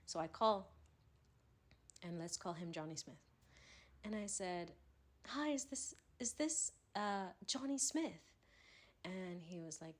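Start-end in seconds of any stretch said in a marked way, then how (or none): 5.3-8.2 higher-pitched mock English accent. Prank caller posing as eBay staff, English accent. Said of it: "do the best English accent".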